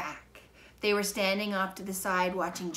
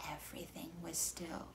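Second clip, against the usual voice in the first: whispered stillness; hushed; 13 dB quieter than usual. A narrator's reading. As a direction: let the hush settle. Spinning stops, hush.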